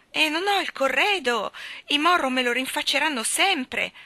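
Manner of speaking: put-on young woman close to tears, high and pleading; distraught young girl. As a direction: play Dovi as a weepy, overwrought young bride. Dovi's voice in story.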